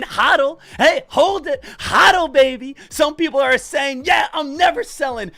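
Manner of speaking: hyped-up shouting trader; loud cocky finance bro. Retold or imitated. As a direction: yell it like a meme stock bro. Hyped meme stock holders.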